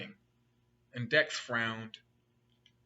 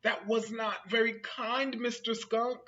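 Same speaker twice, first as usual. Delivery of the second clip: ordinary delivery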